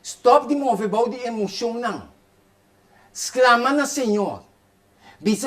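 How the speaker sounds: normal talking voice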